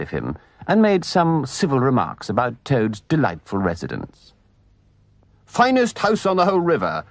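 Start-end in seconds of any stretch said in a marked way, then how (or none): 5.5-7.0 high, exuberant boast; boisterous. A narrator's reading. Toad showing off his house to guests, boisterous. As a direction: crow it out boisterously. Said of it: "cried Toad boisterously".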